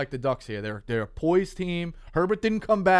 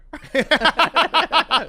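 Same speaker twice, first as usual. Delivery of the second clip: high laugh; 13 semitones higher, 6 dB louder than usual. Real, not acted. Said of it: "There we go".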